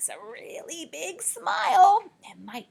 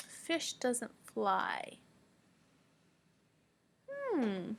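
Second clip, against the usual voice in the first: normal talking voice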